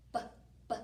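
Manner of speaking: short repeated B, b; isolated b sound. Shown as a demonstration. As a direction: tap out the b sound twice. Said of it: "B is the first sound".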